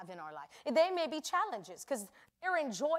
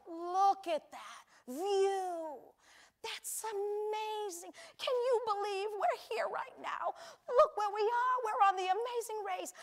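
awed couple; 8 semitones higher than usual. Retold or imitated. Couple on the race marveling at the view, high awe. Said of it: "They're like".

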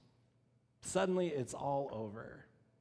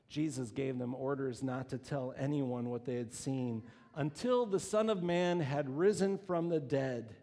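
ordinary delivery

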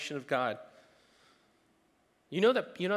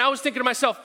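ordinary talk, nothing marked

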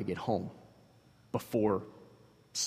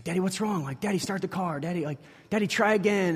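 put-on higher, childlike pleading to Daddy; little daughter pleading; 7 semitones higher than usual. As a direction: beg Daddy like a worried little girl.

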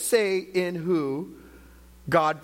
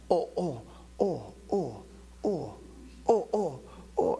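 short falling "oh" moans, repeated; imitated pained "oh"s; 5 dB quieter, slower than usual.

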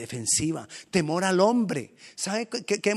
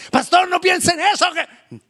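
very high, loud protest; fussing congregant; 11 dB louder than usual. Acted. A worried church member begging the pastor, shrill high loud protest. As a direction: squeal a scandalized objection.

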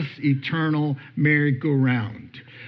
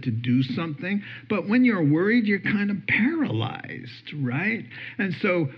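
normal talking voice

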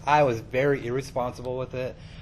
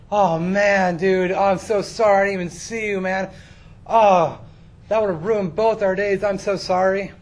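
higher, louder, gushing apology; frantic apology; 6 semitones higher, 7 dB louder than usual. Retold or imitated. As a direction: imitate a mortified mailman apologizing profusely. Acts out apologetic mailman.

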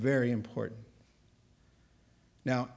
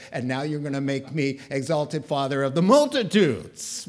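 mildly exasperated protest, building louder to the end; exasperated Abraham; 7 dB louder than usual. Acted. Abraham protests his new name to God, exasperated. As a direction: swell to a mock-indignant outburst.

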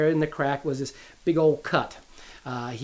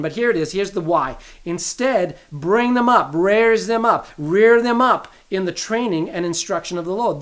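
loud, ringing pulpit call; preaching scripture; 4 dB louder than usual. Acted. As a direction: proclaim it to the congregation.

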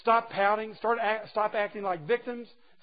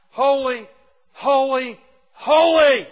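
each holy louder and higher, the last one a full loud cry; building triple holy; 6 semitones higher, 13 dB louder, slower than usual. Shown as a demonstration. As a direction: climb with every holy to a ringing peak. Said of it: "He doesn't just repeat it once. He repeats it twice".

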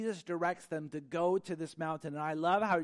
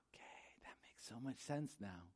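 hushed, breathy realization; awed whisper; 5 semitones lower, 14 dB quieter than usual. Retold or imitated. Speaker realizing God was right all along, whispered. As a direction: whisper it like it finally clicks. Realizing God's reason in hindsight.

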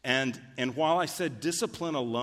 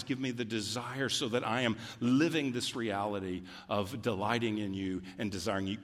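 ordinary talk

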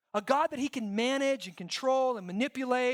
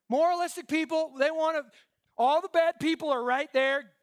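ordinary talk

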